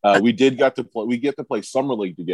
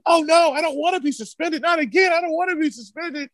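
high, whiny mock plea; mocking whiny Ron. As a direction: squeak it out like a scared kid begging. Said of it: "And Ron starts going like".

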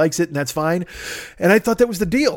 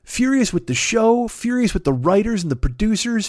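ordinary talk

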